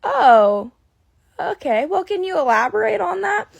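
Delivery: a hint of a flustered clerk keeping her cool; imitating startled interviewer. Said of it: "And she goes".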